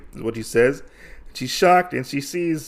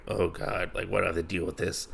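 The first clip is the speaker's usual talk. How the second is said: low, much softer grumble to oneself; imitating quiet, put-upon resignation; retold weary aside; 5 semitones lower, 11 dB quieter, faster than usual. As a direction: drop the voice for an exasperated aside. Acted exasperated aside of Usagi.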